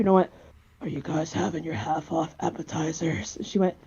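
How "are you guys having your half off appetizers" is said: put-on soft old man voice, slightly low; disguised old man. Caller asking Applebee's about appetizers, disguised as an old man. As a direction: put on a hushed old man's voice. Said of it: "disguise my voice as an old man".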